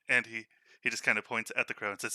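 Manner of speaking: normal talking voice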